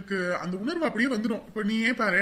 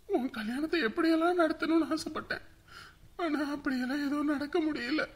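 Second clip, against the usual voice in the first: higher, tearful regret; crying; 5 semitones higher, 4 dB quieter than usual. Acted. Father to newly married daughter Julie as he leaves, crying. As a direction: break into tears between halting phrases. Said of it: "அழாதடா, நீ அழறத பார்த்து".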